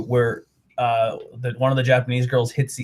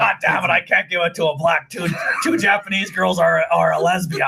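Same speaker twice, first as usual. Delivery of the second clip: higher, shouted mock tirade; angry boss impression; 4 semitones higher than usual. Co-host mocking Vince's views, shouted impression. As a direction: rant like the cranky old promoter.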